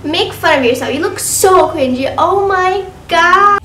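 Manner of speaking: loud outraged yelling; calling out a troll. Pretend. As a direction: shout back at the hater.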